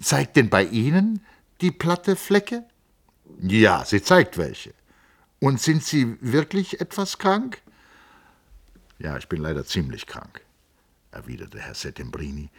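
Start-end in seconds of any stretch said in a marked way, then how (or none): 0.0-7.6 a hint of the curious young engineer, higher; young man asking pointed questions; lightly voiced Hans Castorp. A narrator's reading. Young Castorp questioning the ill Settembrini, lightly voiced.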